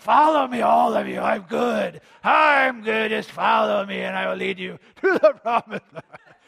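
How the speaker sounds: loud, booming supervillain command; hammed-up masked tyrant calling followers; Doctor Doom villain. Preacher parodies a cartoon villain Antichrist shouting orders. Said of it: "he's not gonna look like Doctor Doom".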